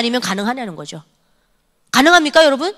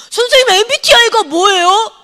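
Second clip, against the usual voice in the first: put-on teasing kid; 10 semitones higher, 6 dB louder than usual. Kids tease the teacher.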